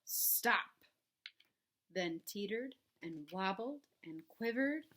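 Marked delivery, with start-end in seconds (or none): 0.1-0.9 unvoiced hushed word; whisper. A narrator's reading. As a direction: breathe it in suspense. Suspense pause in story.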